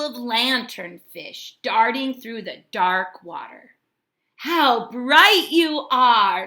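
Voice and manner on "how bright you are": admiring. Acted. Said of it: "said Hermit Crab".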